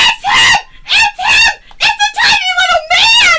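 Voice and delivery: high, excited shout of recognition; thrilled shriek. Real, not acted. Fan spotting the surprise wrestler under the ring, thrilled shriek. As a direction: shriek it high in real amazement.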